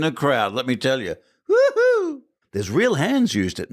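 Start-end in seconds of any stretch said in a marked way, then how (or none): 1.5-2.2 very high-pitched playful "woohoo"; high whoop. Acted. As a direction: let out a high falsetto whoop. Jokes about drugged person spotted.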